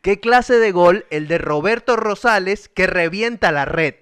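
slow phrase, trilled R's in "Roberto Rosales"; deliberate model of the rolled R's; emphatic R. Shown as a demonstration. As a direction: dictate slowly, rolling the R of "revienta" and "red". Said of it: "le cuesta pronunciar la R".

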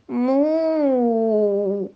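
drawn-out high moo; cow moo. A narrator's reading. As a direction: moo gently like a polite cow. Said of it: "decía atentamente".

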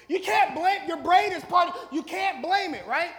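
loud, high, forceful; shouting it to the congregation; preaching shout. Real, not acted. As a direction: hammer the point home. Preaching the point at full force.

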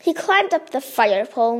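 ordinary delivery, nothing marked

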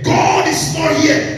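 slow, high mock lament of "God is not here"; complaining believers. Preacher voicing the doubting people, slow and high. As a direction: drawl the complaint. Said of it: "we are saying".

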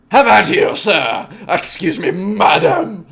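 loud, high mock yell; hammed-up shouting gentleman; yelling snob. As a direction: bellow "how about you" at him. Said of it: "Am I yelling in your ear".

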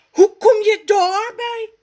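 high, loud angry shout; furious. Acted. Raf explodes at Rommes, very high and loud. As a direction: explode at him. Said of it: "roept hij woedend".

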